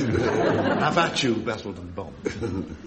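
slow, hammed-up posh rake; fake posh voice. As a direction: drawl it like a dandy duellist. Mock rake, eighteenth century duel.

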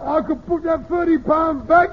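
high, loud imitation of a cry in sleep; mock sleep-talker cry. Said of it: "he says".

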